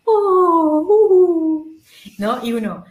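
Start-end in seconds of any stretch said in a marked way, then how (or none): 0.0-1.8 much higher and louder, squeezed-out vowels; mock beginner straining on "re, i, o, u"; strained beginner vowels. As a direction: imitate a nervous beginner forcing the sound. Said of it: "casi no le sale".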